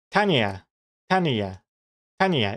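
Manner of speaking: Tanya repeated as TAN-ee-a; three-syllable Tanya. Shown as a demonstration. Streamer trying to be impartial, Tanya in three syllables. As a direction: practise Tanya with ee in the middle. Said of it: "Isn't that how you pronounce it?".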